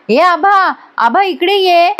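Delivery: calling out. Retold or imitated. Mother calls daughter angrily.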